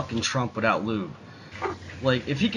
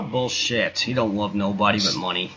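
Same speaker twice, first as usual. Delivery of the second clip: ordinary talk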